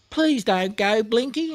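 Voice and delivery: high, pleading little voice; imploring. A narrator's reading. Snubby begging Blinky not to leave him, high and pleading. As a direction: beg him to stay. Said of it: "implored Snubby".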